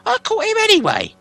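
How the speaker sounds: very high, loud stubborn troll; troll William defiant. Acted. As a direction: refuse like a sulky troll.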